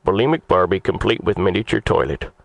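ordinary delivery

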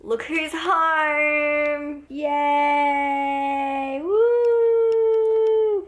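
slow sing-song welcome, rising to a cheer; welcoming someone home. Real, not acted. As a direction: sing out a happy greeting. Partner arrives home.

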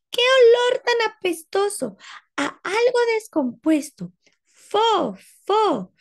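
high, loud cry of disgust at a stink; disgusted girl. Acted. Ada smelling the stink, high disgusted cry. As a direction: recoil from a horrible smell. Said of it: "dijo Ada".

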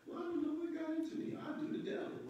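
high, fast, sheepish excuse voice; sheepish offender. Pretend. Preacher mocking an enemy God has humbled, high sheepish voice. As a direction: play the caught troublemaker squeaking out an excuse.

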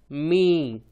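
मी as m plus long ee; blended syllable demo. Shown as a demonstration. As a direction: model मी for learners. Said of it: "तो बन गया".

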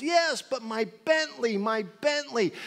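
high, loud, slow wailing over the car; whiny driver. Retold or imitated. Joke driver answering the cop, high wailing lament. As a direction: wail for the lost car.

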